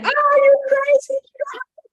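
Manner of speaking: high, screechy mock outburst; loud, very high protest, then dropping in volume. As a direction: shriek it like the doubting voice in your head. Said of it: "The other voice is so much louder".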